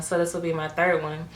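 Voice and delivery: ordinary talk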